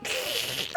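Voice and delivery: sudden high giggle; high squeaky laugh. Real, not acted. Bursts out laughing.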